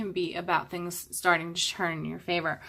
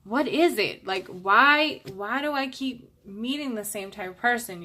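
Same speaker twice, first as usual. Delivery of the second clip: higher-pitched, mock exasperation; exasperated questioning; 4 semitones higher, 4 dB louder than usual. Voicing someone who keeps meeting the same type, exasperated. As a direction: act out someone baffled by their own pattern. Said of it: "you're like".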